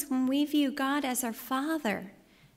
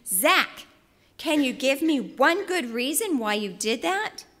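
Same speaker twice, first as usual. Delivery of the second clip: exasperated gasp; 6 dB louder than usual. Retold or imitated. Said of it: "I gasped in exasperation".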